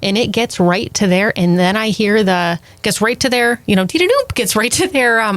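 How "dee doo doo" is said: email chime imitation. Imitating email notification sound.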